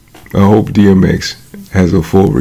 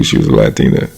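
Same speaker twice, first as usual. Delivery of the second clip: usual speaking voice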